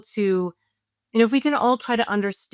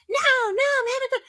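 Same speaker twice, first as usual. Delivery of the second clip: put-on much higher, playful little kid; four-year-old in the bath refusing to get out, high; whiny child; much faster than usual.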